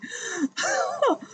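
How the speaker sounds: high delighted giggle; a light, goofy little laugh; kind gentle laugh. Real, not acted. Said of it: "I get goofy".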